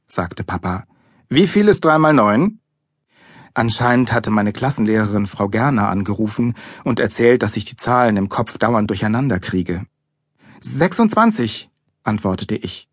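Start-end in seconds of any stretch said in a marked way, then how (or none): 1.3-2.6 louder, higher, pointed question; stern quizzing. A narrator's reading. Father testing his son at maths, stern. 10.6-11.7 high, slow, questioning; unsure guess. A narrator's reading. Boy answering his father's maths question, unsure.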